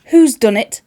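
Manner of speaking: loud demanding cry, stress on Who's; demanding fairy mother. Acted. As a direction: call out who did it, accusing.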